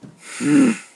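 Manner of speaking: loud exasperated sigh; heavy sigh. Real, not acted. Vlogger sighing after his rant. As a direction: let out a big weary sigh.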